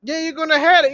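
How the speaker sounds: normal talking voice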